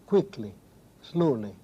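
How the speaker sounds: "quickly, slowly", each hit firmly on its first syllable; stress on the root. Shown as a demonstration. Poet showing an interviewer where English adverbs are stressed, stress on the root. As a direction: lean on QUICK and SLOW, letting each -ly drop away. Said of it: "stress falls on the significant part".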